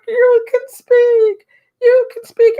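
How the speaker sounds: very high, loud, drawn-out mock cry; miracle-witness amazement. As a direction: imitate a teacher crying out at a miracle. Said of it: "like she just was, witnessed a miracle".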